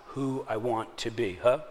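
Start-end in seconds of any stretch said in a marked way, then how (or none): none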